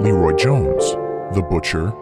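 ordinary talk

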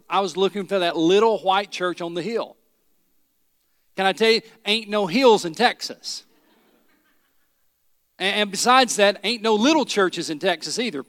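ordinary talk

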